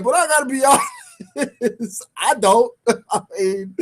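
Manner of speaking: breaking into real laughter mid-sentence; laughing. Real, not acted. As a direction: try to stay honest through the laughs. Laughing at his own blunt joke.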